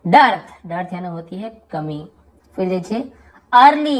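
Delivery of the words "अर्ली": अर्ली with an open first vowel, stressed; EA pronounced as आ. Shown as a demonstration. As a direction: say अर्ली clearly, as a model word. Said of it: "यहाँ पर भी आ उच्चारण". (अर्ली में साफ़ आ उच्चारण)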